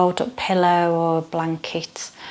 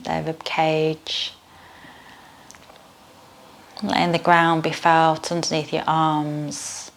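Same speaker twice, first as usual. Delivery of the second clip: guided meditation. Acted. Leads yoga nidra meditation.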